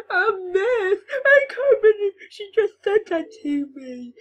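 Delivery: fake-cry to the teacher; tearful mock complaint, high and loud. Boy fake-crying to the teacher.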